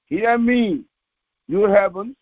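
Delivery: ordinary delivery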